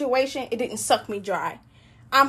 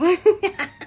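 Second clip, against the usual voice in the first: a bright burst of laughter, higher pitched; 7 semitones higher, 4 dB louder than usual. Real, not acted. Laughing to viewers about letting enemies go. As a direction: laugh at her own hands-off line. Laughs after hands-off remark.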